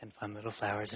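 hushed, low murmur, a bit quick; Bob Ross voice. Retold or imitated. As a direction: narrate it as the soft-spoken TV painter. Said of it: "talking like this the whole time".

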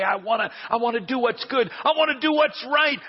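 begging to do right; pleading. Retold or imitated. Pastor voicing his own plea.

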